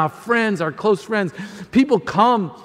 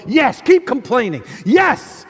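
Jesus sarcastically urging him to keep complaining, higher; sarcastic agreement; 6 semitones higher than usual. Acted. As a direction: tease the complainer with a mock yes. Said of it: "he's sitting there and he's going".

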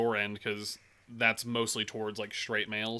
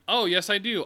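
mock chipper; 9 semitones higher, 5 dB louder than usual. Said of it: "And I was like".

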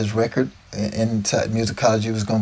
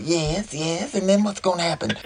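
playing along; 6 semitones higher than usual. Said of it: "I go in with him".

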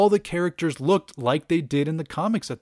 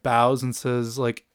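ordinary delivery

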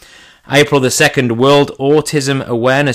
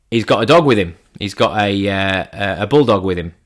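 ordinary talk, nothing marked

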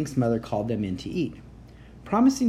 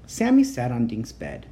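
plain reading voice

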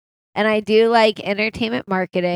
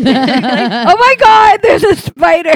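mock spider panic; 9 semitones higher, 10 dB louder, slower than usual.

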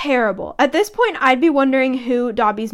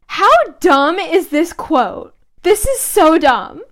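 exasperated; 5 semitones higher, 5 dB louder, slower than usual. Real, not acted. Mocking the quote.

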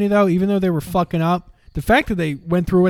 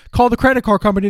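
usual speaking voice

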